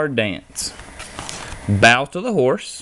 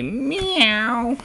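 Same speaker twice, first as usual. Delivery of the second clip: kitten meow; 12 semitones higher than usual. A narrator's reading. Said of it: "And cats and kittens say".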